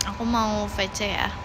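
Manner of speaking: ordinary talk, nothing marked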